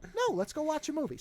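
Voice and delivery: high-pitched mild innocent refusal; virtuous innocent. Retold or imitated. Man turning down her advances, lightly voiced innocent. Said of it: "angling him as a virtuous innocent".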